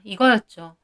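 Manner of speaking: ordinary delivery, nothing marked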